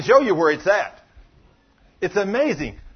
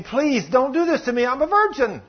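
begging; 8 semitones higher than usual. Retold or imitated. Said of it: "she begs him".

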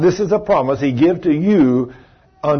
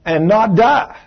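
bold declaration; 5 semitones higher, 4 dB louder than usual. Shown as a demonstration. Preacher modeling the declaration for believers, bold and loud. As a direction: proclaim it with full conviction.

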